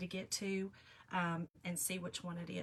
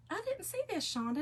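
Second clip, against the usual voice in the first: mock husband, much higher, a little startled; husband's surprised voice; 11 semitones higher than usual. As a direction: play the puzzled husband who missed the video. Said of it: "he said".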